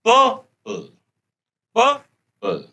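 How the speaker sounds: पर्पल said pəpəl with no r; पर्पल with the r silent; r dropped. Shown as a demonstration. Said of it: "र नहीं आएगा".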